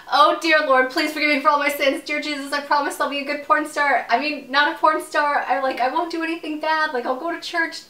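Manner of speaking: high, loud, hammed-up pleading; mock desperate prayer. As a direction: plead with God in playful panic. Acts out desperate prayer over balcony.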